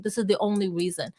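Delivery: normal talking voice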